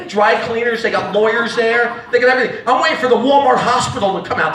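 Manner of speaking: normal talking voice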